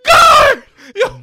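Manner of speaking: high desperate yell; shouting. Retold or imitated. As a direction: scream for the guard. Reenacting prisoner yelling for guard.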